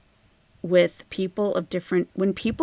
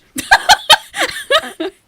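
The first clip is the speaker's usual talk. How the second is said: high breathy burst of laughter; whispered laugh; 8 dB louder than usual. Real, not acted. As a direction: laugh it out under your breath. Laugh after whispered insult.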